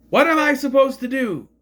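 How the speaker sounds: high, loud exasperated question; exasperated protest. Retold or imitated. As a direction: throw it back as a frustrated protest. Acting out Maxine's exasperated reply.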